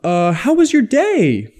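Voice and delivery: rapport-seeking rising tune; upward inflection. Shown as a demonstration. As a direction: pitch climbing high into a question. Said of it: "it could sound something like".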